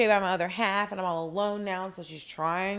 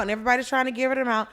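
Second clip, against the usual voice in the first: usual speaking voice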